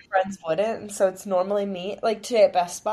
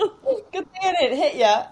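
mock quote laughing; 9 semitones higher, 4 dB louder than usual. Friend mocks the quoted apology.

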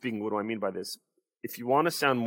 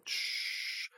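static shush; 13 dB quieter than usual. Shown as a demonstration. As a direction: hiss out static.

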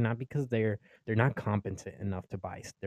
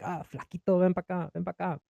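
high mock parent calling out in Spanish; parents calling out nickname; 6 semitones higher than usual. Parent calling son across the room, mock high calls. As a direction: call the kid over like a nagging parent, higher. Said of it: "have your parents say that in front of your family".

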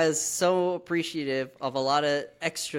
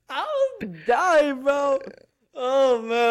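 high, drawn-out dramatic wail; overcome fan; 8 semitones higher, 4 dB louder, slower than usual. Real, not acted. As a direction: wail it as if the scene floored you. Recalls being overcome by scene.

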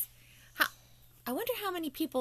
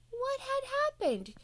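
puzzled wonder; 14 semitones higher than usual. A narrator's reading. Narrator asks suspenseful question.

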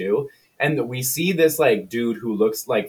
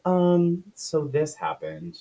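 awkward admission; 6 semitones higher, slower than usual. Retold or imitated. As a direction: sheepishly break bad news. Acted awkward reveal of the death.

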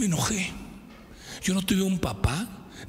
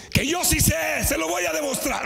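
defiant shout; 7 semitones higher than usual. Retold or imitated. Student vowing to prove the teacher wrong, shouting. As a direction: hurl the vow at him. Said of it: "defiant vow at teacher".

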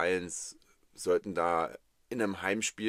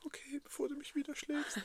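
mock scared; 7 dB quieter than usual. Mock fear after being hit.

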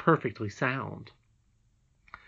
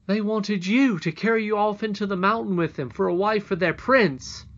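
put-on high, forceful boy's voice; agitated boy; 8 semitones higher, 6 dB louder than usual. A narrator's reading. Curdie telling the princess the cobs' plot, agitated. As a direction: reveal the plot loudly and urgently.

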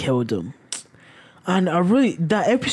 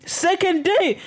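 much higher mocking disbelief; incredulous; 12 semitones higher, slower than usual. Real, not acted. Mocking the idea of Jeff dating, incredulous. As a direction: scoff at the idea, up high. Mocking Jeff's second date.

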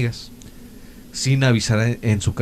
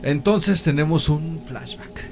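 dramatic narrator; 4 semitones higher, 6 dB louder than usual. Acted.

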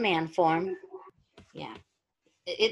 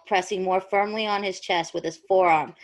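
normal talking voice

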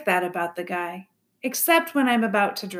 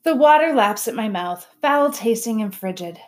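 plain reading voice

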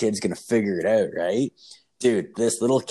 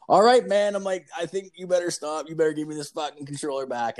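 uneasy pleading; 7 semitones higher than usual. Retold or imitated. Babysitter tells the kid to hand over the controller, alarmed. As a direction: start high and urgent, then ease down.

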